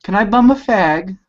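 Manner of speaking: put-on weak stab at a British accent; mock British accent, barely there; bad British accent. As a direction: attempt British slang in a failed accent. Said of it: "That's not even close".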